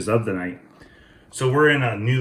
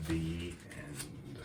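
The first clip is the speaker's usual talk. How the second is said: story ending; 5 semitones lower, 17 dB quieter than usual. A narrator's reading. Closing the story.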